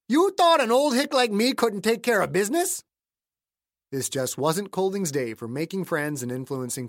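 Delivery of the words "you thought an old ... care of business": put-on affronted accented old-timer; offended old man. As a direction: throw the challenge out high and indignant. Voices offended caretaker Clayton.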